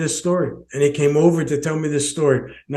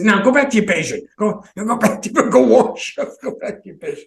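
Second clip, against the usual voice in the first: playful laughing shoo; 5 semitones higher than usual. Retold or imitated. Retold playful shooing of surgeon.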